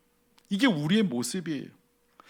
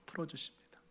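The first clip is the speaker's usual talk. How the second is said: 풀어주십니다 almost inaudible, voiced and falling away; 풀어주십니다 trailing off very softly; barely audible; 18 dB quieter than usual. Real, not acted. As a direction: let 풀어주십니다 fade to nothing. Repeated even softer.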